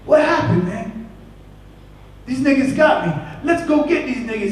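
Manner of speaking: put-on high, urgent [name] in a Jamaican accent. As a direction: fire him up to ride. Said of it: "[name] shows up instead".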